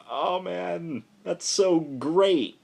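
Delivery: high, drawn-out gush of delight. Retold or imitated. Reviewer recalling his own reaction, slow delighted gush. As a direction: savor it slowly, thrilled. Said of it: "I was like".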